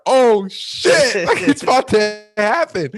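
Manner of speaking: high, loud burst of retold excitement; excited outburst. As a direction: blurt it out high and loud, thrilled. Acts out excitement on draft call.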